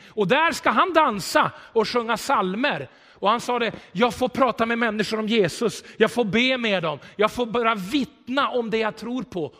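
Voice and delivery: loud, high, driving sermon voice; full-force preaching. Acted. Pastor to congregation, preaching loud and high. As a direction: preach it out to the whole church.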